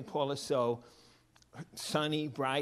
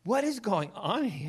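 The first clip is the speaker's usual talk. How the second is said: raised, louder mock amazement; astonished dad, voice lifted; acted astonishment; 7 semitones higher, 4 dB louder than usual. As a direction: play a baffled father, pitched up. Father baffled at boy's glee.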